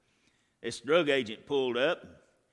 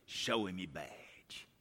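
low, soft and slow; soft slow low delivery; quiet, slow; 4 semitones lower, 7 dB quieter, slower than usual. Retold or imitated. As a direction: say it quietly, holding back. Holy Spirit, first quiet take.

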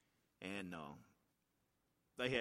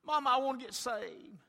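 put-on child pleading; 13 semitones higher, 13 dB louder than usual. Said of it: "pulled on my skirt and said".

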